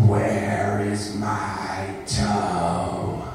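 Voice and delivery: slow, low drawn-out groan; groaning ghost voice.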